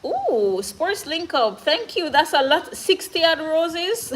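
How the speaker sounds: high, excited thanks; delighted surprise. Real, not acted. Streamer thanks a viewer for sixty roses, delighted.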